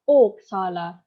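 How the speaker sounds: oxala with a loud first syllable, "O-xa-la"; stress on first syllable. Shown as a demonstration. Lecturer showing word stress to students, oxala stressed hard on O. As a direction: punch the O in oxala. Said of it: "and also in the first syllable".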